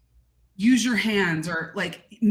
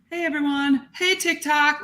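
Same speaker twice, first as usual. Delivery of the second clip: higher-pitched generic creator hello; mock video greeting; 8 semitones higher than usual. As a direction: imitate a stock TikTok intro, pitched up. Mocking a typical video greeting.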